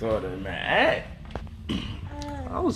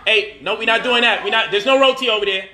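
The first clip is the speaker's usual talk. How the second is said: louder, higher playful telling-off; playful scolding; 7 semitones higher, 4 dB louder than usual. Real, not acted. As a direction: shoo her off with a laugh. Stopping baby from reaching.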